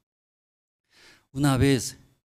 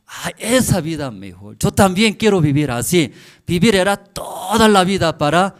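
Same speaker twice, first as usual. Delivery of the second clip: loud, high impassioned declaration; fervent resolve; 7 semitones higher, 10 dB louder than usual. Retold or imitated. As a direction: preach it as a rousing, heartfelt vow. Voicing the listeners' fervent resolve. (declaración ferviente, fuerte y aguda)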